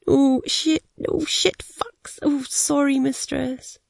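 high, airy voice for a flustered submissive; mumbling submissive. A narrator's reading. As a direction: narrator imitating his helpless, apologetic mumbling. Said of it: "I hear him mumbling into his knicker-filled mouth".

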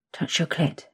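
soft whisper in the ear. Acted. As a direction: breathe it into her ear. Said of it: "you whisper into my ear".

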